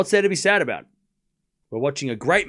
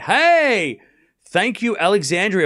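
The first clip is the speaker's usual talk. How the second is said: excited greeting; 5 semitones higher, 5 dB louder, slower than usual. Real, not acted. Greets new chatter.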